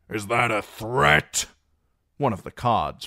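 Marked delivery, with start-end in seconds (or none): 0.1-1.5 louder, slow menacing question; bristling challenge. Acted. A cod challenging Reek, loud and slow. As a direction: square up to him.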